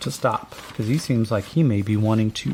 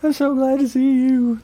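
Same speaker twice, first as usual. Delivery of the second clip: tearful rancher; 11 semitones higher than usual. Acted. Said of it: "drops to his knees and begins crying".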